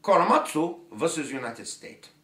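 hammed-up fake Japanese accent; bad Japanese accent. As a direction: put on a badly faked Japanese accent. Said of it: "that's the worst Japanese dialect".

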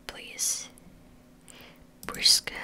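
ordinary delivery

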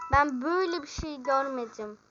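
normal talking voice

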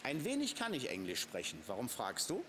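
ordinary talk

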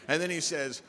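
ordinary talk

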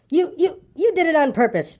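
high-pitched stammered accusation; spluttering. A narrator's reading. Piglet with a mouthful of soap, spluttering at Kanga. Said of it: "spluttered Piglet".

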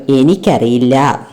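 low, slow reply from a father; busy father. Acted. Father answers the child's question while at work, low and slow. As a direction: answer as a dad occupied with work. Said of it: "ജോലിക്കിടയിൽ അച്ഛൻ പറഞ്ഞു".

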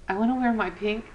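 ordinary delivery

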